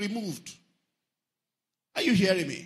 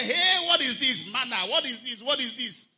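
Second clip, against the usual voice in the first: loud, high cry of amazement; excited wonder; 5 semitones higher, 6 dB louder than usual. Acted. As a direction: marvel loudly at a brand-new thing. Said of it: "Manna was exciting for a while".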